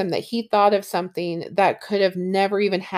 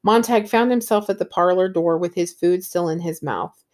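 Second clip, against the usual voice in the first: ordinary delivery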